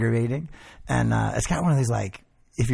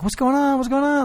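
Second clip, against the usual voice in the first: mock panic; 11 semitones higher than usual. Retold panic at skunk smell.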